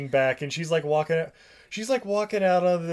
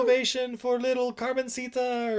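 high, bright mock cheer; cheering crowd; 9 semitones higher, slower than usual. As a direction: cheer her on.

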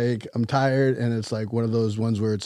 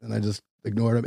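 ordinary talk

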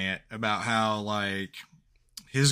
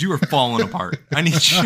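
mock desperate plea; 6 semitones higher, 6 dB louder, much faster than usual.

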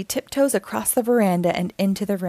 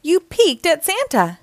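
higher, louder mock scold; 8 semitones higher, 3 dB louder than usual. Bluey as Santa scolding the peeking kids, mock scold. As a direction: tell off the naughty children. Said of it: "she scolds".

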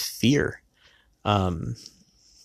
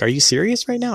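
much higher, sharp disbelief; incredulous outburst; 12 semitones higher than usual. Retold or imitated. Husband retelling snapping at his wife in the store, high indignant. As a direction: fire back in mock outrage.

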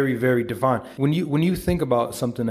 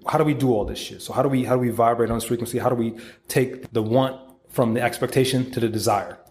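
ordinary talk, nothing marked

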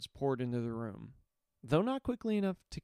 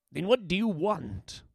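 demanding warlord; 6 semitones higher, 5 dB louder than usual. Acted.

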